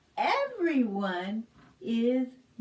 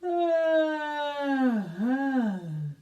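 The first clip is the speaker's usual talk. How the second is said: long open-mouthed yawn; big pretend yawn; acted yawn. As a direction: yawn wide like the crocodile.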